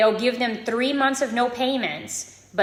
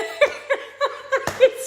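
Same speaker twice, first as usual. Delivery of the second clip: giggle. Real, not acted. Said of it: "I make myself laugh".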